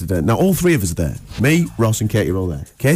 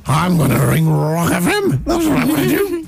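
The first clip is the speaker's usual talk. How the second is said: smug inner voice. Retold or imitated. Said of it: "he said it exactly like that in his head".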